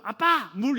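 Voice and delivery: put-on child calling for water. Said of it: "갑자기 애가 뭐 새벽 두 시 이때쯤".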